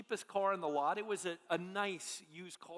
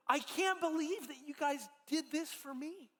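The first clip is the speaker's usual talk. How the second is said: higher, a touch overwhelmed, lightly voiced Sandy; overwhelmed thanks; 6 semitones higher than usual. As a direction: lift it in grateful disbelief, mildly.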